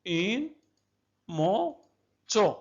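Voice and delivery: إن then موتو drawn out very slowly; slowly spelled-out syllables for students. Shown as a demonstration. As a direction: stretch موتو slowly after a pause. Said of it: "أعيدوا".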